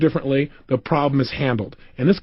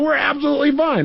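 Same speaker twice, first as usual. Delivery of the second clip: higher, mock-insistent woman's voice; emphatic reassurance; 9 semitones higher than usual. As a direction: imitate her insisting all is well. Voices woman insisting all is well.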